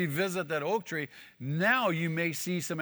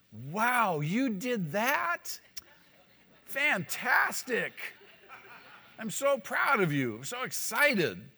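high, slow, gushing mock delight; mock proud praise; 7 semitones higher, slower than usual. Preacher voicing an imagined pleased Jesus, gushing mock praise. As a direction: overdo the proud parent, sarcastically.

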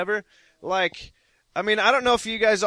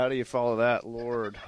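ordinary talk, nothing marked

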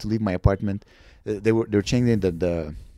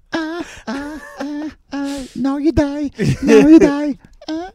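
much higher, crying out then loud villain threat; hammed-up killer shrieking now you die; mock stabbing; 7 dB louder, slower than usual. Joking attacker stabbing the boy, high hammed-up threat. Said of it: "And like".

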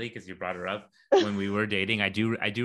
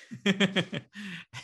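helpless high laugh, losing it; real laughter breaking out; burst of laughter; 11 semitones higher than usual. Real, not acted. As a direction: crack up remembering it. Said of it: "I totally lost it".

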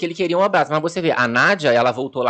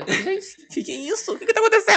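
high, startled falsetto squeak; shocked high voice; 3 dB quieter than usual. Retold or imitated. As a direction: gasp it out way up high. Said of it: "Aí ele".

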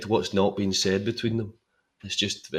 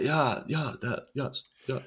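mock posh stammer, softer and a bit higher; posh Hugh Grant; 4 semitones higher, 7 dB quieter, slower than usual. A posh Hugh Grant fumbling his reply, mock posh and softer. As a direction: play the plummy toff, hedging politely. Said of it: "So posh".

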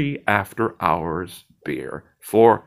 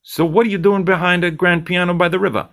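higher, pushy bartender lecturing his friend; cocky bartender Zed; 10 semitones higher, 5 dB louder, faster than usual. Acted. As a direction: needle him like the slick bartender.

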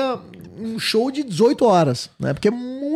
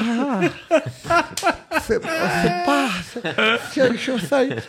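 stammering recruit; 5 semitones higher, 3 dB quieter, much slower than usual. Retold or imitated. Soldado gago travando.

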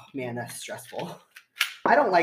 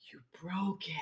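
whisper; 3 semitones higher, 12 dB quieter than usual. Real, not acted. Gasp at broken cork.

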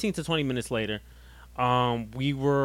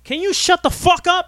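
loud, high-pitched angry demand; exasperated outburst; 7 dB louder than usual. Real, not acted. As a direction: shout down the co-host who keeps interrupting. Snaps at interrupting co-host.

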